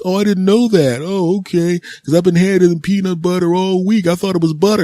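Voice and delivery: high, put-on clueless voice; clueless daycare worker. Daycare worker excusing the peanut butter to a parent, clueless. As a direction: play a dim worker fumbling for an excuse.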